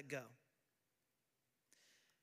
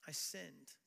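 sincere apology; 4 semitones lower, 7 dB quieter than usual. Shown as a demonstration.

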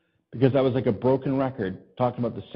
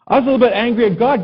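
raised, quick and loud; emphatic; 10 semitones higher, 7 dB louder, faster than usual. Real, not acted. Preacher telling the congregation, loud and quick. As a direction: punch out the anger at God.

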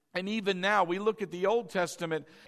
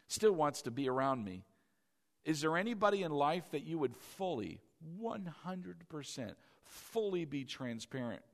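much softer, intimate and lower; tender hushed preaching; 5 semitones lower, 7 dB quieter than usual. Acted. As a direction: draw them in close and gently. Soft intimate appeal to the congregation.

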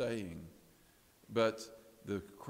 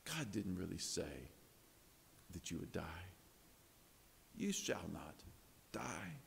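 much softer, hushed, tempting words; sly whisper; 6 semitones lower, 11 dB quieter than usual. Retold or imitated. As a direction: lean in and whisper the lie to Eve. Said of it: "he whispered into Eve's ear".

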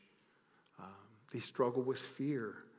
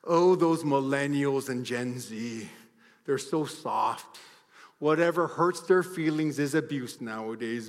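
scornful, eye-rolling mockery; dismissive older person; 9 dB louder than usual. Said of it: "rolled their eyes at me and said".

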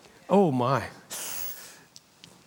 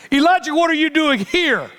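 HERE punched and held at the end; higher voice, landing hard on HERE; stress on HERE; 8 semitones higher than usual. Shown as a demonstration. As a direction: drive the whole high-pitched question toward the word here. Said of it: "I emphasized each word".

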